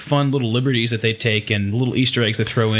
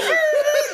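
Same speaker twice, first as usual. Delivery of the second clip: shrill squealing laugh; squealing laughter; high squeal of a laugh. Real, not acted. Laughing at own bit.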